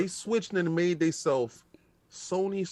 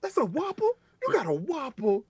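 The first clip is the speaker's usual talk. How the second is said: mock amazement; 10 semitones higher than usual. Customer amazed at gas-station Whopper.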